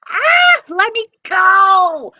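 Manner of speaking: high, loud cry for help; panicked shout. Acted. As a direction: yell as you're grabbed. Giant snatches Jack up.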